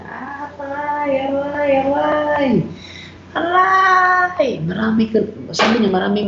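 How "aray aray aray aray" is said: pained cries. Retold or imitated. Priest imitating a man crying out in pain during deliverance, high drawn-out wails. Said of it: "Sumigaw siya".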